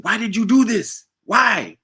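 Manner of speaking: high imitated Jamaican accusation; Jamaican accent. Roommate holding the joint, accusing Bo, Jamaican accent. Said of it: "he was Jamaican".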